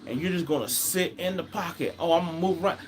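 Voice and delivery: high, exasperated scolding of a passive quarterback. Retold or imitated. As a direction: lecture him like a frustrated fan yelling at the TV. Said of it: "I'm like, it's like".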